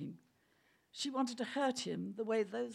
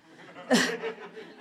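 unvoiced snicker; stifled laugh; breathy laugh; 6 dB louder than usual. Real, not acted. Reader stifling a laugh.

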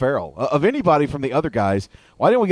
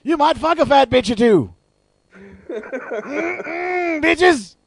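Samuel L. Jackson voice; much slower than usual. Pretend. Jackson beer ad impression.

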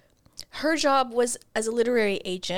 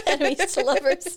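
higher, laughing ironic echo; laughing mockery; 4 semitones higher than usual. Real, not acted. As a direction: scoff at the label through a laugh. Mocking the book's label.

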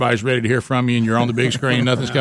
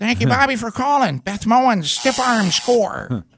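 very slow high broadcaster call; announcer imitation; 7 semitones higher, slower than usual. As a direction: stretch it like a hyped sportscaster.

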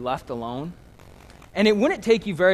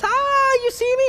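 put-on little girl popping out; 8 dB louder than usual. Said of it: "she jumps out and goes".

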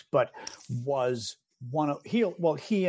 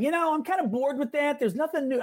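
high, hammed-up imitation of a bored pundit; mock James Carville; 11 semitones higher than usual. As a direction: play a cocky, bored strategist, pitched way up. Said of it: "Carville starts the interview by going".

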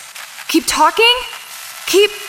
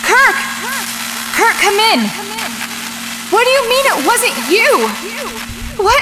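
alarmed shouting; 8 semitones higher than usual. Acted. Radio cuts out, alarmed.

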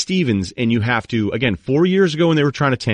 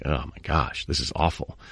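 softer, lower mock moan; mock despair; 7 semitones lower, 8 dB quieter than usual. Fans on lottery night moaning, softer mock despair. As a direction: sink into fake gloom.

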